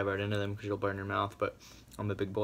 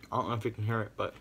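talking between bites; crunchy food in mouth; mouth full while chewing; 3 dB quieter than usual. Real, not acted. Seen 2: Bites pizza bagel piece at 1.4–2.1, chews throughout, mouth visibly full.

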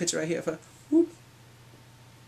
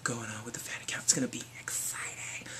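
soft conspiratorial whisper; 5 semitones lower, 8 dB quieter than usual. Acted. Vlogger whispering to viewers about the fan account. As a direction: lean in and whisper.

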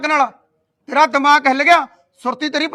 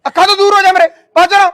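loud, higher yelling to get out; furious shout; 5 semitones higher, 7 dB louder than usual. Acted. Enraged husband ordering his wife out of his sight, shouting. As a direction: drive her out of your sight with a roar.

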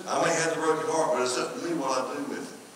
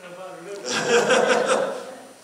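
quick chuckling burst of laughter; amused, bubbling laugh; 6 semitones higher, 3 dB louder than usual. Real, not acted. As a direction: laugh out at your own joke. Laughs at own little something.

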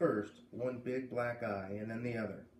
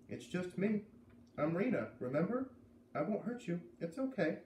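gentle higher coaxing voice to a cow; soothing softly; 6 semitones higher than usual. A narrator's reading. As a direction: coax the shy heifer gently, a bit higher. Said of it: "I talked softly".

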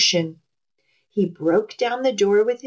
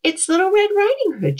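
calling out; 11 semitones higher, faster than usual. A narrator's reading. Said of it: "she called".

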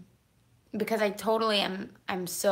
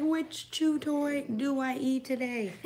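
voicing the dog; 5 semitones higher, slower than usual. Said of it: "You're like".

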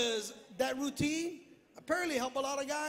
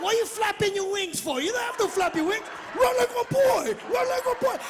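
higher, louder coaching yell; yelling at son; 7 semitones higher, 5 dB louder than usual. Retold or imitated. Father yelling at young son to run like a boy. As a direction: shout him into running like a boy. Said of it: "I'm like".

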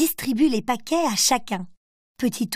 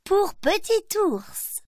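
slow, higher granny voice; granny offering a present; grandma voice; 8 semitones higher, much slower than usual. A narrator's reading. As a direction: hand over the gift slowly. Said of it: "Mamie prend un paquet et dit".

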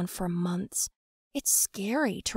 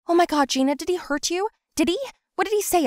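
alarmed concern; 10 semitones higher, 5 dB louder, faster than usual. Acted. Finding friend crying.

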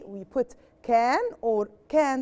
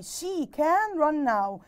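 slow reading; 4 semitones higher, much slower than usual. Shown as a demonstration. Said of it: "reads the sentence slowly again".

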